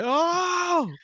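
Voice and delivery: very high shrieking oh no; hammed-up falsetto shriek; Riddler's wail. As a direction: wail it like a broken villain. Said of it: "he's just like".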